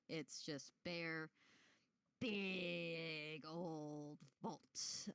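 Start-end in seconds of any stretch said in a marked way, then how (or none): 2.2-5.1 very slow, stretched-out words; drawn-out emphasis. Acted. Game master describing a big vault, drawn out. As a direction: draw out every vowel for size.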